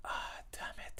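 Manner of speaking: whispered retold curse to himself; very soft, whispered mock dismay; muttered dismay. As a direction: curse under your breath, as if only thinking it. His private reaction, retold.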